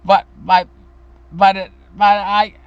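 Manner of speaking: put-on little boy crying to his mother to get up; tearful kid. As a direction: sputter out a crying boy's buts. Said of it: "I'd start crying and boo-hooing".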